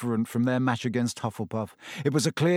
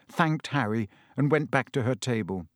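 plain reading voice

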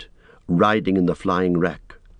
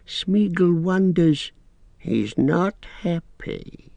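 high, very slow whining creature; sulky and uneasy; Gollum voice; 6 semitones higher, slower than usual. Acted. Gollum answers Sam.

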